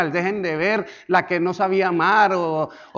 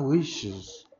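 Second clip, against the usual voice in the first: ordinary talk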